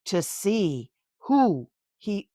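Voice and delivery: storybook narration, lively and clear; bedtime-book reading voice; reading to a child. A narrator's reading. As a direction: read it aloud to a little one.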